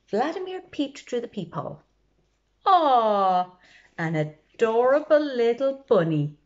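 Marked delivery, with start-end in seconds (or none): 2.7-6.4 high, louder coo over a cute bunny. Acted. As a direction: aww it like a cute pet. Vladimir coos at the bunny.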